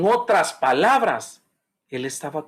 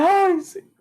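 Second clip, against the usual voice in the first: mock whimpering lament; 4 dB louder, slower than usual. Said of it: "pero en las noches".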